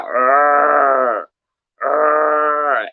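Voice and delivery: loud mock monster growl. Imitating the Scooby-Doo monster chasing them, growling. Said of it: "he'd be behind them, like".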